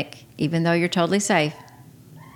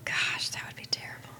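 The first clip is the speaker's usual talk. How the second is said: hushed, voiceless whisper; 13 dB quieter than usual. Pretend. Podcast partner pondering a life of only whispering, whispered. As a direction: whisper it very softly.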